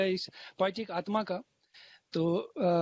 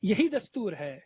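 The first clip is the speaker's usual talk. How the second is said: stately nazm recitation; 4 dB louder than usual. Acted. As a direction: lean into each line, then pause. Recites a fresh ghazal, repeated lines.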